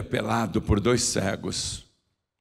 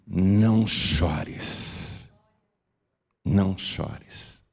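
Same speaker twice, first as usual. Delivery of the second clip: tender comfort; 4 semitones lower, much slower than usual. Acted. Jesus voiced gently.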